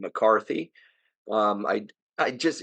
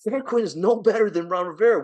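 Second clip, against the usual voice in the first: New Jersey accent, pitched up and louder in exasperation; 9 semitones higher, 4 dB louder than usual. Real, not acted. Host ranting to co-host about the coach hire, New Jersey accent. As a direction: let the New Jersey accent take over as you get worked up. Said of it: "my New Jersey accent coming out there".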